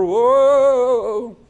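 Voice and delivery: loud high mock wail; hammed-up mourning cry; mourners' wailing whoa.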